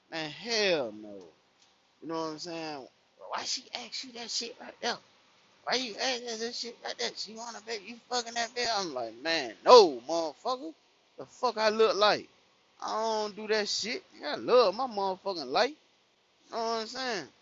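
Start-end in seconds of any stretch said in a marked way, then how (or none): 3.3-8.7 high-pitched imitation of a woman; mock jealous interrogation, pitched up; accusing girlfriend. Girlfriend accusing him, acted out. 9.1-15.7 loud, high, indignant protest; worked-up acted comeback to an accusation; retold raised-voice denial. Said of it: "I don't raise my voice".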